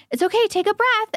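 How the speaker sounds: high-pitched imitation of a small child; little daughter comforting. Daughter telling her cousin to breathe, imitated in a high child voice. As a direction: mimic a little kid reassuring someone, voice way up. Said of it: "so cute to see her tell, like, my, her cousin".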